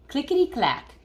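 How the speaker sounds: put-on lively read-aloud voice for kids; storybook reading. Teacher reading a picture book to her class, lively.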